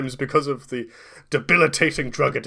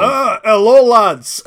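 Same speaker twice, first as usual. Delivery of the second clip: high, loud, hammed-up Scottish brogue; Scottish accent; 9 semitones higher, 11 dB louder, slower than usual. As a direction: boom out a greeting in a thick Scottish accent. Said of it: "Breaks out the Wallace Agentman accent".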